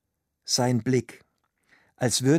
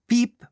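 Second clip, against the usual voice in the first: high, short imitated beep; high-pitched mimicked tape tone; answering-machine beep; 10 semitones higher, 5 dB louder than usual. A narrator's reading. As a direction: squeak out a single electronic beep. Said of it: "kam es vom Band".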